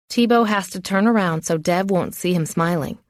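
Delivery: usual speaking voice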